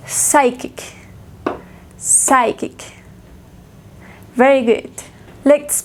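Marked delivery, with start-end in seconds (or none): 0.1-2.8 "Psychic" begun on a hissed S, no P; silent P. Shown as a demonstration. Teacher modelling "Psychic" for learners, silent P. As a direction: say "Psychic" as SAI-kik, P left out. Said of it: "no se lee la P".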